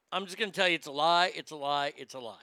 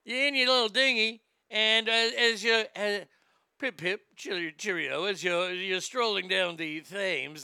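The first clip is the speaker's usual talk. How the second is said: plummy mock-British pitchman slipping into Australian; hammed-up British-Australian accent. [name] setting up a dinghy mishap, hammed-up British accent. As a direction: put on a bad British infomercial accent. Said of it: "It's all going Australia for some reason".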